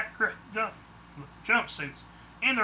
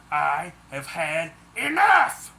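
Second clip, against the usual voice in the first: slow, louder furious declaration; snapped angrily; 7 dB louder, slower than usual. A narrator's reading. Daisy, fed up with the poachers, slow and angry. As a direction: drag it out in fury. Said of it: "she snapped angrily".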